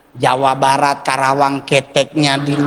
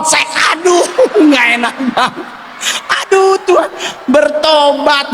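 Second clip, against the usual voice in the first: panicked cry; 11 semitones higher than usual. Retold or imitated. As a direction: wail in mock panic at the slipping koteka. Panics as koteka slips off.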